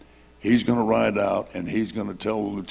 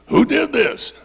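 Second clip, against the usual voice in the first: very high, loud commanding question; demanding officer; 8 dB louder, slower than usual. Retold or imitated. Lieutenant demands an answer from the rider, very high loud shout. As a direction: bark it like a cavalry lieutenant.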